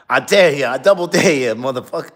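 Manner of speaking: loud, high, menacing tough-guy dare; Samuel L. Jackson. Retold or imitated. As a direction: shout the dare like a movie gangster. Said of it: "Samuel L. Jackson".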